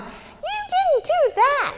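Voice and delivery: high, slow, accusing mock blame. Mocking how a family member gets blamed. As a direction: point the finger in a shrill-high accusation. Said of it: "And it's not".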